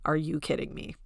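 soft, low muttered disbelief; quiet Shannon mutter. Retold or imitated. Host imitating Shannon grumbling far away, soft and low. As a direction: mutter it under your breath. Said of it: "Somewhere Shannon is saying".

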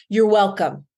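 usual speaking voice

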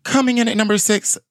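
high, projected announcer voice; hammed-up high announcer opening, easing down; countdown announcer.

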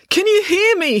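high, loud call to a crowd. A narrator's reading. Dad addresses the crowd through a microphone, calling out. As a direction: project it right up and out.

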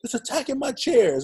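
high, whiny protest; mock high-pitched kid complaint. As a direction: plead your case to the teacher. Protesting to the teacher.

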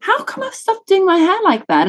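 sudden high realization; lightly puzzled self-question; high surprised. Retold or imitated. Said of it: "I went".

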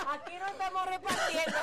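loud laugh, then words through it. Real, not acted. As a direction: laugh out the line. Laughing at the joke.

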